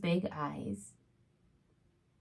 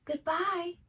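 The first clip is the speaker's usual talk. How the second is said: higher, lightly called farewell; calling goodbye; 11 semitones higher than usual. Acted. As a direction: call it across the forest gently. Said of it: "Hedgehog called".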